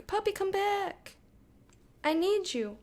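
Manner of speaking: high pleading call to a dog; calling the puppy. Real, not acted. Streamer calling her puppy back, high and pleading.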